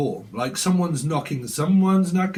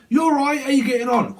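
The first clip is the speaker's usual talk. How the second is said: mock cheery partner; 9 semitones higher than usual. Voicing the partner's cheery reply.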